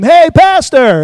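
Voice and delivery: loud, high, drawn-out call across the street; slow, loud greeting shout. Retold or imitated. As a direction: hail the pastor from a distance. Church member calling out in town.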